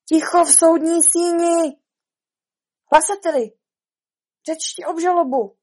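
high, loud herald's call. Acted. Rabbit to the court as herald, calling out. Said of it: "Králík zvolal".

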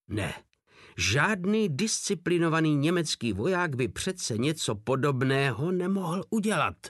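plain reading voice

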